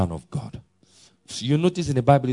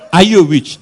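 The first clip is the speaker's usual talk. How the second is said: louder, higher, demanding shout; loud challenge; 5 semitones higher, 10 dB louder than usual. Retold or imitated. Idahosa confronting the man, loud and higher. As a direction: confront him with the question.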